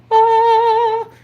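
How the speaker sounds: high, loud swooning oh; awed oh. Pretend. Imagining a fridge full of food, swooning loud high oh. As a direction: gasp of mock wonder at a feast.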